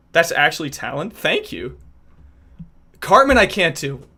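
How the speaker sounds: normal talking voice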